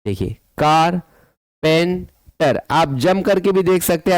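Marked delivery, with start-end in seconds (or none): 0.6-2.6 "कारपेंटर" with long gaps, कार, पें, टर; split into syllables. Shown as a demonstration. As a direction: isolate each of the three syllables of "कारपेंटर". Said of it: "आप तीन बार jump कर पाएंगे".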